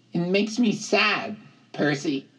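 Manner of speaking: high, plaintive character voice; high-pitched, sorrowful satyr; mournful satyr. A narrator's reading. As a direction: lament it to a friend in a high voice. Grover voiced in story.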